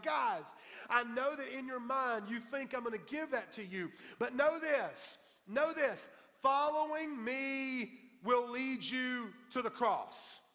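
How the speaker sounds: high, loud preacher voicing Jesus, drawing out the last phrases; emphatic warning. Jesus warns his eager followers.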